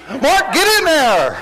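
high, loud, slow coach shout; coach yelling. Retold or imitated. Retold coach calling the player in, loud. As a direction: bark the boy into the game. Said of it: "when he turned around".

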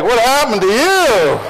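laughing, high and loud; laughing quote. Retold or imitated. Preacher retelling his own laughing question. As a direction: burst out laughing while asking. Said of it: "I was like".

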